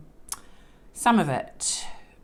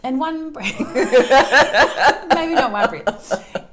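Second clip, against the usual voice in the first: laughing through the words; giggling at her own joke; 7 semitones higher, 10 dB louder than usual. Real, not acted. Joke about reading in one breath.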